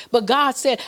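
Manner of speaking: usual speaking voice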